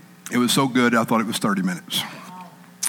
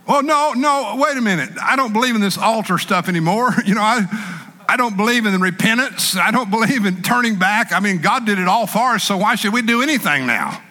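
higher, excuse-making whine; higher mock protest; reluctant congregant; 8 semitones higher than usual. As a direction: play a churchgoer dodging the altar call. Congregant excusing himself, preacher voices.

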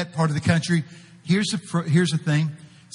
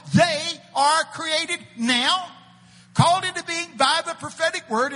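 high, raised pulpit reading; preaching scripture; 10 semitones higher than usual. Acted. Preacher reading Isaiah to the congregation, full pulpit voice. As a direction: proclaim the verse to the crowd.

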